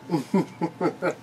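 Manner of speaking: short soft laugh. Real, not acted. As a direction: chuckle quietly. Laughing at the story.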